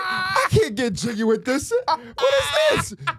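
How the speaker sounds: loud, high, laughing outburst; excited shout. Retold or imitated. Reacting to the song on stage.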